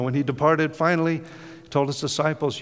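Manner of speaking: ordinary delivery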